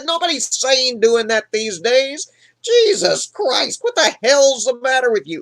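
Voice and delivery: high, exasperated scolding; outraged witch. Retold or imitated. Woman berating the man who wants a witch, outraged. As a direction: berate in high-pitched disbelief.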